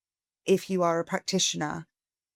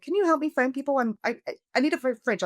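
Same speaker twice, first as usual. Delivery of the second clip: high, rushed panicked asking for help; retelling her own frantic plea about the broken fridge; 8 semitones higher, faster than usual. Retold or imitated. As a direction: pitch way up, flustered and urgent. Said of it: "I was like".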